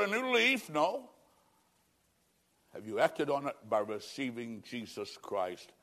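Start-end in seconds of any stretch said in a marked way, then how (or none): 2.7-5.7 much lower and softer; hushed altar call. Real, not acted. Preacher inviting listeners to receive Jesus, hushed. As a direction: drop to a near hush of invitation.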